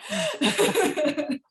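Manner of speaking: loud high burst of laughter; laughing after teasing a friend. Real, not acted. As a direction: crack up at your own bit. Laughing at own joke.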